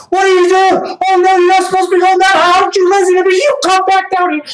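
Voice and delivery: high, loud, fast playful telling-off; mock scolding. A dad hams up scolding his airborne kids, high and loud. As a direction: pretend to scold a giggling kid.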